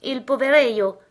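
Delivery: energetic cry. A narrator's reading. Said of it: "cria-t-il tout à coup d'une seule voix, avec une grande énergie".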